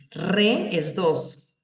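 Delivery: very slow, each word laid out for the class; slow teaching emphasis. Shown as a demonstration. Teacher slowly explaining a word part to the class. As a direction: spell it out slowly for students.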